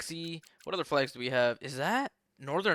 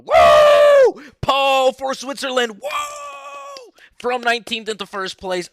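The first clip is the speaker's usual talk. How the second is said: excited commentator shout; 10 semitones higher, 15 dB louder, slower than usual. Real, not acted. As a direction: yell it like a caster at the race start, much higher and louder. Shouting live race commentary.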